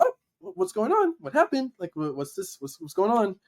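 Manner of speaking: high-pitched, flustered mock alarm; startled godmom. Imitating godmom who heard the shout, high and startled. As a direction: imitate a startled woman stumbling over questions.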